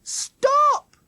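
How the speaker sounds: high, loud one-word shout; sudden shout. A narrator's reading. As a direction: shout it suddenly to halt your friends. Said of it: "Jackie shouted".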